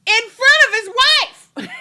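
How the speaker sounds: high, loud, drawn-out indignation; mock outrage. Reacting to a pass at a married woman, mock outrage. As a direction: cry out scandalized, slow and loud.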